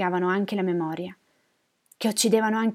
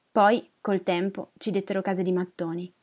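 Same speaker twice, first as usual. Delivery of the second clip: plain reading voice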